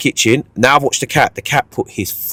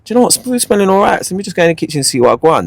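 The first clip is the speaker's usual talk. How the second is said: hungry inner voice; 8 semitones higher, faster than usual. Retold or imitated. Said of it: "Anyway, I thought".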